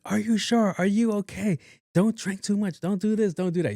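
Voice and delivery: high-pitched nagging list; imitated fussing mom. Mom warning daughter who is out drinking, mock fussing. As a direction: fuss in a high, put-on mother voice. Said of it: "moms just being moms".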